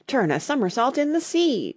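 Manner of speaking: high, excited exclamation; crying out. A narrator's reading. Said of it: "cried the Mock Turtle".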